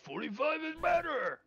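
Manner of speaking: very high, strained ogre protest; high-pitched indignant creature; arguing ogre. Acted. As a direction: argue stubbornly as a dim ogre. Ogre disputes the times.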